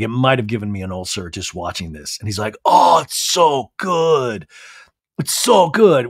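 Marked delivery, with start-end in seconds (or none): 2.6-6.1 loud, drawn-out savoring; mock relish. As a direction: imitate him savoring it, loud and slow. Imitates man relishing chugged dressing.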